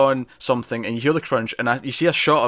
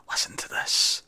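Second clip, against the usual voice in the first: whisper; 6 dB quieter, slower than usual. Retold or imitated. Retold character showing a sound.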